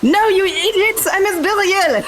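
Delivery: high, drawn-out exasperated shout; hammed-up shrill-pitched outrage; indignant yell. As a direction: wail at the fools who mistake you. Body-swapped character protests being mistaken.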